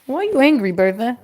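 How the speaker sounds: usual speaking voice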